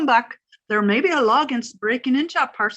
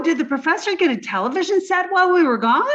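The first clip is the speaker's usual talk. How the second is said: ordinary talk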